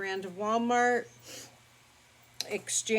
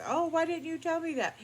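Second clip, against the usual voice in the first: surprised; 10 semitones higher, faster than usual. Retold or imitated. Piercer surprised by bleeding.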